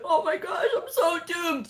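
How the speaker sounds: high, whiny mock panic; mock dramatic despair. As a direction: parody someone melodramatically doomed. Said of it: "Just so dramatic".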